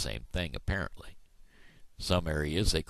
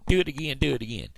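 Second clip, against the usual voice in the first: higher, louder coaxing to a dog; playful urging; eager coaxing; 8 semitones higher, 4 dB louder, much faster than usual. Retold or imitated. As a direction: egg the dog on. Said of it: "And I'd say".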